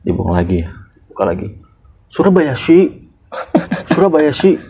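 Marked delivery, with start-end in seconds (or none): 2.1-4.6 put-on Japanese accent. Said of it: "tourist voicing".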